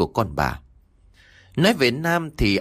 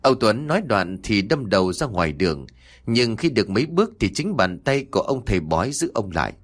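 ordinary delivery, nothing marked

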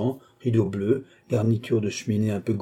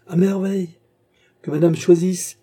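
marchand empressé; 8 semitones higher, 6 dB louder than usual. Acted. Marchand flatteur, voix montée.

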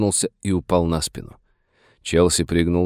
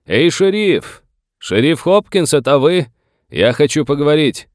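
high, loud call across open ground; raised, carrying shout; booming distant hail; 8 semitones higher, 4 dB louder than usual. A narrator's reading. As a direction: hail the sheriff from far away. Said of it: "раздался зычный голос Моргана Челси".

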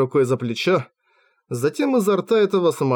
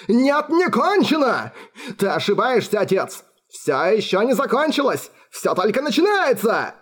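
much higher, raving son defying his father; frenzied defiance; 8 semitones higher than usual. A narrator's reading. Alfred furiously refusing his father, high and raving. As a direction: rave it out, wild and defiant. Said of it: "яростно покачал головой".

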